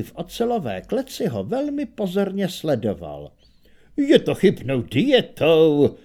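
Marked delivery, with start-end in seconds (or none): 4.0-6.0 confident tone. A narrator's reading. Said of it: "sebejistým tónem Leonardo".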